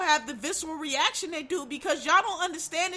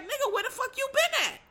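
higher, pitched-up mock demand; indignant outburst; 9 semitones higher than usual. The mother confronting the absent father, indignant and higher. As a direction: fire it at him like the wronged mother. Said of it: "she's reacting the way she should".

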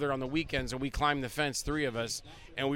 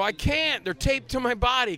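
higher-pitched pleading explanation; retold protest; 8 semitones higher, slower than usual. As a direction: protest to the cops, voice raised in pitch. Retold protest to armed police.

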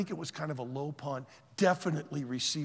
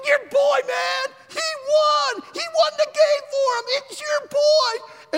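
very high, loud, stretched-out yelling; ecstatic shouting; 11 dB louder than usual. Retold or imitated. Fan congratulating the player's parents in stands, shouting. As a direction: holler it like an overjoyed fan.

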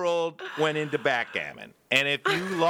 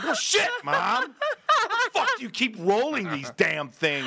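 mock exasperated outburst; 9 semitones higher than usual. Acts out losing son's outburst.

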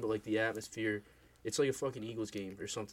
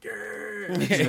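crowd cheering; 9 semitones higher, 8 dB louder than usual. Retold or imitated. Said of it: "They're like".

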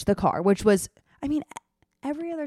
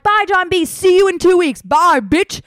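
higher, louder sing-song farewell; cheeky loud goodbye; 8 semitones higher, 7 dB louder than usual. Retold or imitated. Traveler calls goodbye to friend John B, loud and cheeky. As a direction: shout a cheeky bye while running off.

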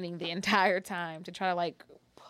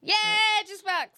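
high bright cheer; 14 semitones higher, 6 dB louder, much slower than usual. Real, not acted. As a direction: celebrate him loudly. Cheering a friend.

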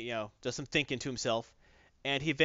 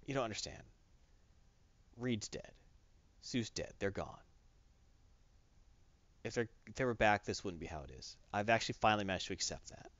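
quiet grief; 4 semitones lower, 8 dB quieter than usual. Retold or imitated. Grieving Ben quote.

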